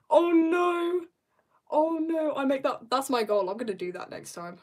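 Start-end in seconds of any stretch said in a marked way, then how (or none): 0.1-2.3 loud, high, drawn-out mock horror; playfully aghast at a disaster; hammed-up dismay. Reacting to a dropped fragile package.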